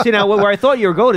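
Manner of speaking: ordinary talk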